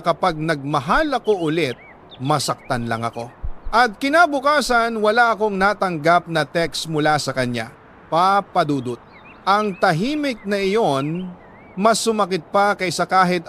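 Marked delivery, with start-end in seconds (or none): none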